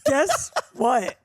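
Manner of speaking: "Guess what?" replayed with awkward, misplaced emphasis; worst-ever delivery. Shown as a demonstration. Host replaying own botched setup, "Guess what?" awkwardly stressed. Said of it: "that was my worst delivery ever".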